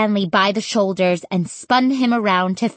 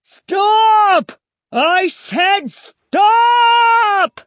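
very high, loud, long-held cry; screech; 14 semitones higher, 6 dB louder, much slower than usual. A narrator's reading. Stanley ordering scarecrows back, drawn-out screech. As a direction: screech the order, stretching out the last word. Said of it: "Stanley screeched".

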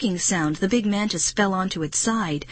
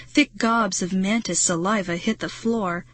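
plain reading voice

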